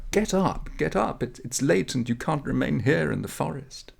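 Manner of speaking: put-on a high, urgent young girl's voice; eager village girl urging him up; young girl waking him. Girl wakes Menashe in forest.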